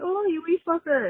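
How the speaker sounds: a mock Irish accent, deliberately bad. Caller quoting Derry Girls at home in a bad Irish accent. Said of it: "trying to do my best Irish accent that's really bad".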